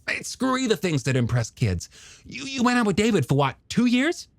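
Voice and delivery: high, animated character voice; eager younger brother. Acted. Hooper greeting his brother's old girlfriend, high eager character voice. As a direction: play a keen, friendly scientist, pitched well up.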